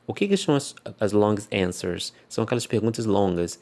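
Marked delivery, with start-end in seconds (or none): none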